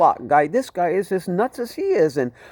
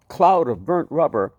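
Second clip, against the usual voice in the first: ordinary delivery, nothing marked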